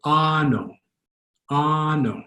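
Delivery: "ano" with an open ah first vowel; drawn-out first vowel in "ano"; Tagalog pronunciation. Shown as a demonstration. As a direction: say "ano" with a long open ah, stressed up front. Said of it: "how would that be pronounced?".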